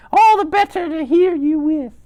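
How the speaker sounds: loud falsetto granny; exaggerated high fake-grandma voice; wolf posing as grandmother. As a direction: sing out very high as the disguised wolf. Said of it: "like that".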